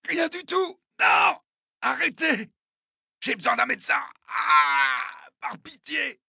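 very high, strained cries; agonized pleading. Acted. Wounded attacker pleading for a doctor, in screaming pain. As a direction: beg for mercy between screams. Tortured man begging for a doctor.

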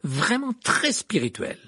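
high, slow sarcastic praise. A narrator's reading. Hermione to Pansy and friends, sarcastic. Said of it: "d'un ton sarcastique".

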